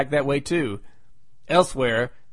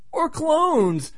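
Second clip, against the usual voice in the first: suddenly much higher, teasing aside; playful interjection; 11 semitones higher than usual. Pretend. Narrator butting in with another theory, playful high pipe-up. Jokey interjected suggestion, sing-out pitch jump.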